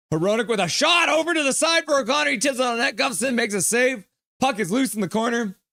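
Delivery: high, urgent hockey commentary; excited play-by-play. Real, not acted. Announcer calling a shot and save, excited play-by-play. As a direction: call the scoring chance in a high, excited voice. Live play-by-play of a scoring chance.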